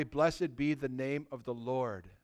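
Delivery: bow the head and bless him softly; reverent hush. Retold or imitated. Job in his suffering blesses the Lord, reverent and soft.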